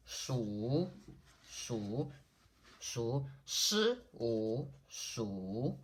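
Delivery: "shu" drawn out, dipping low then rising; falling-rising third tone. Shown as a demonstration. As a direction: say "shu" with a slow dip and rise, then split it as "shi, wu". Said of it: "Shu is the falling and rising tone".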